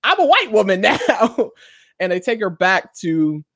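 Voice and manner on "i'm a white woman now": high-pitched panicked wail breaking into laughter; mock freak-out. Woman who woke up transformed, mock freaking out. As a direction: act out her panic, half laughing. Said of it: "she's, like, freaking out".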